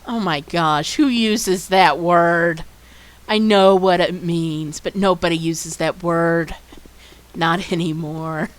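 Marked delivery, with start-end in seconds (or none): none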